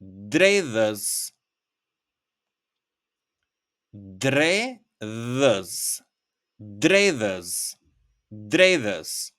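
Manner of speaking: dredhës repeated for learners; word demo. Shown as a demonstration. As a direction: model dredhës carefully for the class. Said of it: "you can say".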